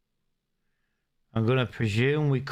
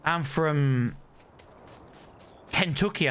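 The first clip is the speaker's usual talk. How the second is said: mock American; 5 semitones higher than usual. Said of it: "they were like".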